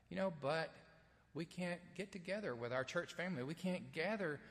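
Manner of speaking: ordinary delivery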